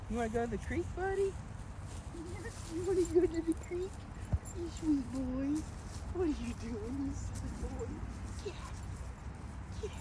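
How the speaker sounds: softly cooing to his dog on the trail. Real, not acted.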